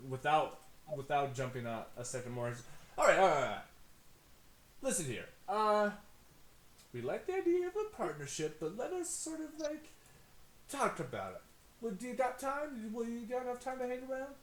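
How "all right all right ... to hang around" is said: high, slick salesman patter; smooth-talk a business partner; fast-talking hustler. Acted. GM voices Tony.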